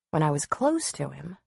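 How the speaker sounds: ordinary delivery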